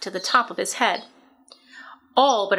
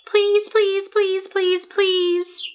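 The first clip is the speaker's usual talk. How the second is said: teasing goslings; 10 semitones higher, much slower than usual. A narrator's reading. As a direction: pester like a flock of little geese. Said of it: "all seven began teasing".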